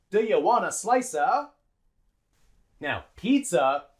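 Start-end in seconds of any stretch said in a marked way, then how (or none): 0.1-1.5 "Do you want a slicer" as "duh-yuh wanna slice-uh"; linked reductions. Shown as a demonstration. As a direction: run the words together with short schwas. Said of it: "we link it onto slice".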